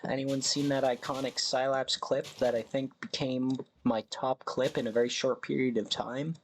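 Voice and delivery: ordinary talk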